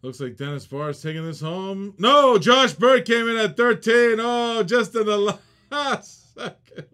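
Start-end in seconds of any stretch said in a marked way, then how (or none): 2.0-6.9 sudden loud, high call of surprise; real thrill at a late winning bid; excited outburst. Real, not acted. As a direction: blurt it out as the last-second bid lands.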